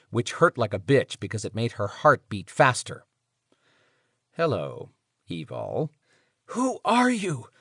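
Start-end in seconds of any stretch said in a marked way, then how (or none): 4.3-5.9 low sinister greeting. Acted. Sinister captor greets her in dark. 6.5-7.5 hoarse, raw-throated question; rough voice. Acted. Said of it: "Evil asked in a rough voice".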